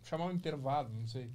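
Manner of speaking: talking while chewing; mouth full. Real, not acted. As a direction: mumble through a mouthful. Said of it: "vou comer aqui".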